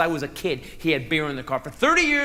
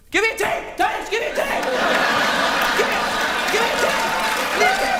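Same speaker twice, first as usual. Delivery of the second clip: higher and louder with each "give me a tape"; a kid getting ever more desperate for the tape; frantic begging; 4 dB louder than usual. Retold or imitated. Little brother begging for tape.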